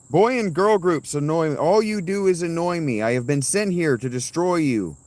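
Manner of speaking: loud, high villain declaration. Pretend. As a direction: boom it like a cartoon villain. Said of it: "I woke up today with the line".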